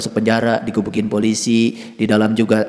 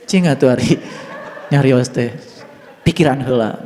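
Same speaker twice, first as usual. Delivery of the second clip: chuckling through the words; laughing. Retold or imitated. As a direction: laugh while retelling the reply. Laughing retelling of own reply.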